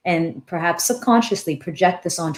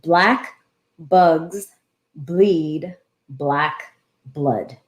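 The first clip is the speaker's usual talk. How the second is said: slow word-by-word demo; much slower than usual. Shown as a demonstration. Host teaching viewers a tongue twister, slow and deliberate. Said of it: "And the tongue twister is".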